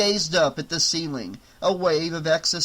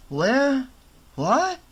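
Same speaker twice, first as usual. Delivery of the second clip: higher, rising bewildered questions; confused surprise; 9 semitones higher, 3 dB louder than usual. Acted. Half-conscious guard asks the paramedic, baffled and high. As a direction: grope for what's happening.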